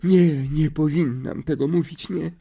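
high, slow, trembling seer voice; theatrical seer wailing over the boy; anguished seer. A narrator's reading. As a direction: clutch your heart and refuse to tell. Trelawney overcome.

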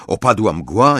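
ordinary delivery, nothing marked

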